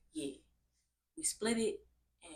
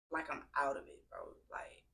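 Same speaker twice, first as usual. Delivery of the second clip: normal talking voice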